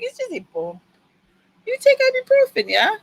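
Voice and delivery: imitated nonchalant French doctor; French-accented brush-off with a questioning end. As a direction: put on a French accent, unconcerned. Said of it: "he is French".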